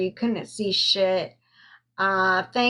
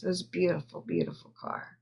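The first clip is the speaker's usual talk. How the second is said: softer and breathless, words barely voiced with grief; choked whisper; 8 dB quieter, much faster than usual. Real, not acted. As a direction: let the voice give out into a whisper on beautiful car. Grieving the car from dad.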